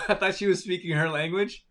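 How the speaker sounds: high, loud, laughing through it; can't stop laughing while telling. Real, not acted. Laughing as she tells viewers the joke.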